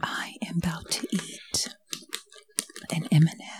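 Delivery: soft, whispered ASMR announcement; hushed mock-ASMR whisper; just whispering. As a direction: whisper it into the mic. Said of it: "now you're just whispering".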